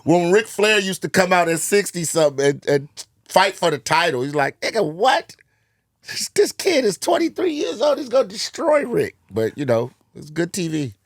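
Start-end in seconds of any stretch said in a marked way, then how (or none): 4.6-9.2 high-pitched, disbelieving outburst; mock incredulous. Podcast host voicing disbelief at an old wrestler's title fight, incredulous.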